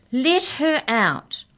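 slow, high, loud plea; cry out. A narrator's reading. Arthur rattling the cage bars, slow loud cry. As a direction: drag it out, loud and desperate. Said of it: "cried Arthur".

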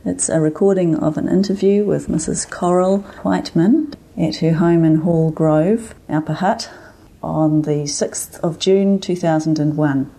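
measured, formal archive introduction; formal announcement. Acted. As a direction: read it like a formal record header. Reads the archive recording intro.

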